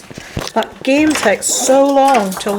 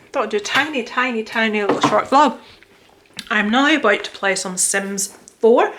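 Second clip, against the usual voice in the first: usual speaking voice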